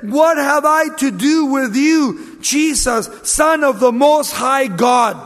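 high, loud declaiming cry. Acted. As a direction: cry it out at full voice, drawn out. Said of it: "cried out with a loud voice".

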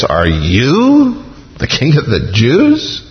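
emphatic, stretched "you", then a rising finish; stress on you. Shown as a demonstration. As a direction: lean hard on "you", rising in disbelief. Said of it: "you" there is emphatic".

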